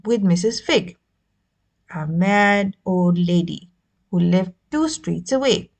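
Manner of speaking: usual speaking voice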